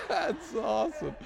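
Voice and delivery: breathy high laugh into the words; can't help laughing at the joke. Real, not acted. Pastor laughing at his own quote.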